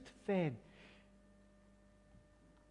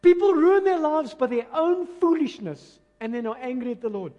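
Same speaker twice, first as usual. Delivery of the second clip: loud, raised preaching voice; high, declamatory repeat of scripture; emphatic scripture reading; 5 semitones higher, 8 dB louder than usual. Real, not acted. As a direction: proclaim the verse forcefully. Preacher repeats the verse forcefully.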